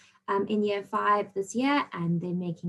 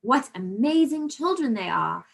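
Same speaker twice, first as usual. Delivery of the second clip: higher, emphatic example exclamation; enthusiastic exclamation; 5 semitones higher, 3 dB louder than usual. Shown as a demonstration. Teacher giving pupils an example exclamation, enthusiastic. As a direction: read it out with demonstrated enthusiasm. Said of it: "with quite a lot of enthusiasm".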